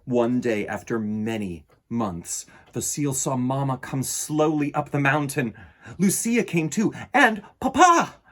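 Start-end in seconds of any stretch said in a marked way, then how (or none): none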